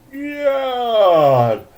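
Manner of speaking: high, drawn-out yawn on the word; sleepy stretched yawn. Acted. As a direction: yawn it out lazily.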